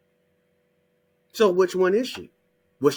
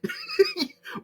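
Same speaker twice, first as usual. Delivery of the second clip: squealing high laugh; high cackle. Real, not acted. Laughing at his own joke, a high squealing cackle.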